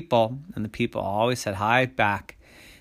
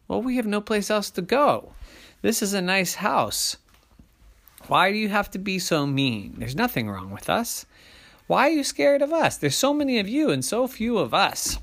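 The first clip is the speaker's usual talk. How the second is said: high-pitched plaintive protest; pleading big person; 9 semitones higher than usual. A narrator's reading. Big person asking monsters why they are mean, pleading. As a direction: plead your case in a raised voice.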